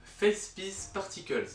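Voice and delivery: English term in a strong French accent, slow and high. Real, not acted. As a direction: read out English with French sounds, carefully drawn out. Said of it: "mon accent anglais est, est, est magique".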